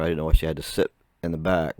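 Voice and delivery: normal talking voice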